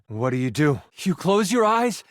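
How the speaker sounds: normal talking voice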